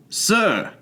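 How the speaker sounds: a sharp high startled cry of sir; astonished exclamation. Acted. The rescued patient, hearing the king blessed, exclaims in astonished surprise. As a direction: blurt it out, high, in sheer astonishment. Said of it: "exclaims Mister Dodsworth".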